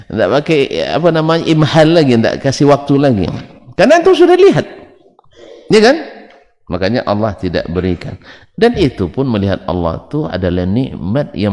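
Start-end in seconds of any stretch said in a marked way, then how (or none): 3.8-6.0 higher, louder burst of emphasis; emphatic exclaim. Real, not acted. Preacher stressing the point to the congregation, high emphatic exclaim. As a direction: throw the point out high and strong.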